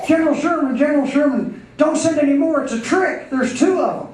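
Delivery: high, urgent alarmed report; frantic warning. Acted. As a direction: warn the general desperately, pitched right up. Bloodied survivor warns Sherman.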